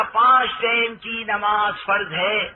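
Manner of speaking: slow, drawn-out public proclamation; اعلان. Acted. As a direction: stretch it out like an announcement. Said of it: "اعلان ہوا". (کھینچ کر کیا گیا اعلان)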